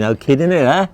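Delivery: playful Cardiff accent from the slums of Splot. Shown as a demonstration. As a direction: slip back into the Cardiff accent of his childhood. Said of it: "with a Cardiff accent".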